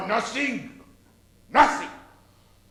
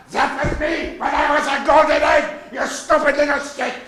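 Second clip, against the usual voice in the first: raised, higher mock-German rant; scolding outburst; 5 semitones higher, 5 dB louder than usual. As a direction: snap at the little ones in mock fury. Mother Goose scolds a chick.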